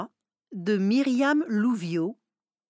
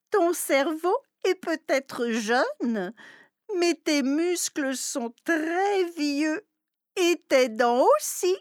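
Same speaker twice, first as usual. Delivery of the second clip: teasing companion; 6 semitones higher than usual. Acted. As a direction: needle the old cat about his age. Second voice teases the old cat.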